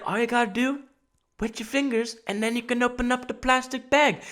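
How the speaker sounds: put-on high, soft old-man voice; old dude. Old dude offering a shopper a tip, put-on elderly voice. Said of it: "this old dude came up to me".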